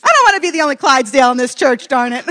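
loud, fast comic complaint; mock exasperated outburst. Lone Clydesdale complaining to the church, mock exasperated shout.

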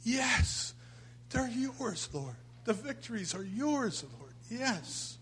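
very high, hushed yes to the Lord; rapt prayer. Pretend. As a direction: breathe it out in awe to the Lord. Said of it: "light shooting into my spirit and saying".

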